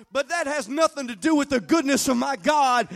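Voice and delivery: loud, high, driving preacher's cry; full-force preaching. Acted. Pastor rousing his congregation to praise, preaching at full force. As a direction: preach it hard to the back row.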